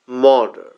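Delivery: Murder as "MUH-duh", silent r; long first vowel in Murder, weak ending; model pronunciation. Shown as a demonstration. Teacher has everyone try along, Murder as "MUH-duh". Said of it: "আমার সাথে সবাই try করেন".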